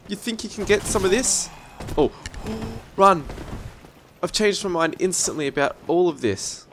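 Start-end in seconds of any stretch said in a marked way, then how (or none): 2.1-2.9 sharp gasp. Real, not acted. Enemy attack in game.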